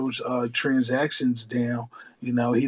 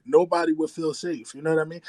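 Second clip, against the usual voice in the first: ordinary delivery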